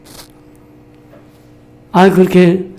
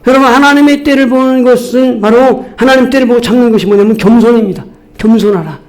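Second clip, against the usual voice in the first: emphatic preaching; 4 semitones higher than usual. Real, not acted.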